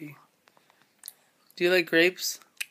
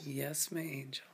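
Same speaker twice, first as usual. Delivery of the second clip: whispering; 3 semitones lower, 7 dB quieter than usual. Real, not acted. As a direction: breathe it softly and lovingly. Answering baby tenderly.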